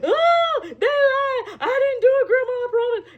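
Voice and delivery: high, pleading, near tears, hammed-up; dramatic denial. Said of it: "that whole dra-, dramatic".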